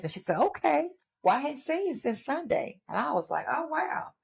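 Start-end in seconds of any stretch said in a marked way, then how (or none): 0.4-2.8 put-on high, teasing old grandmother voice; voicing her grandmother. Grandmother teasing her for staying away since Sunday, high-pitched. 3.5-4.1 high, soft retold oh wow; surprised. Reacting to her grandmother's sharp remark with soft high surprise.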